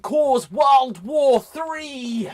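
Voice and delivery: slowed, loud doom announcement; booming trailer-voice warning; dramatic slow shout. Acted. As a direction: drag out every word like a movie trailer.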